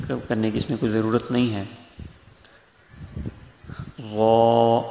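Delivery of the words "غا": غا said heavy and louder; letter demo. Shown as a demonstration. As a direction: sound out غا thick. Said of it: "آگے عین ہے".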